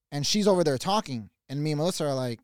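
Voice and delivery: ordinary talk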